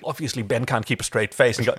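usual speaking voice

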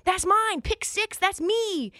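high, gloating claim; gloating pick six. Retold or imitated. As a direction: crow over the interception. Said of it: "Jesse Bates comes through ... and says".

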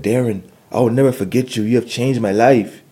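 a hint of an excited older gentleman; quoting a grateful old man. Older gentleman's words retold.

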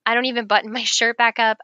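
ordinary talk, nothing marked

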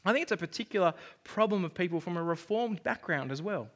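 usual speaking voice

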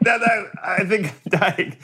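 high, slow whimper; hammed-up dying man, slow and high; feeble dying plea. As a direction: drag it out, pleading weakly. Dying Sam pleads.

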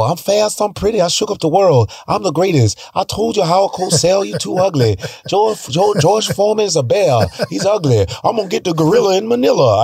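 high, brash boxing boast; trash-talk like a young Ali; Muhammad Ali impression. Child boasting to his watching father as Ali, brash. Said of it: "I could do voices".

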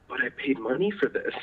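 mock inner panic. Apprentice silently panicking as mentor shrugs off wasted wood, mock panic. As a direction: whine it to yourself like a secret worry. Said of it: "I'm just freaking out".

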